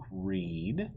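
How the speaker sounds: usual speaking voice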